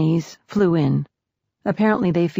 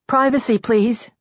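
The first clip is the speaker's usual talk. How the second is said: calling out; 6 semitones higher, 3 dB louder than usual. A narrator's reading. Calling back through a closed door.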